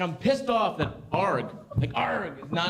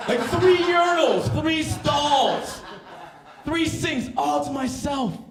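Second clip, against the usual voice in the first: gleeful boasting; 8 semitones higher, much slower than usual. Acted. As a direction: list your treasures with glee. Gloating about own bathroom.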